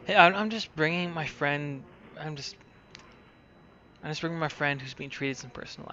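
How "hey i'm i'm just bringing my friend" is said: normal talking voice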